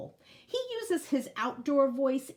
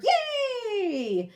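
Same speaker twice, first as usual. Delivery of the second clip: high, loud happy cheer; squealing kid cheer; yay cheer; 12 semitones higher, 6 dB louder than usual. Acted. Superhero drawing cheer.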